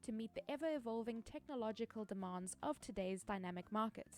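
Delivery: soft, even sponsor read. Acted. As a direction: read it like a polished ad. Reads a sponsor ad.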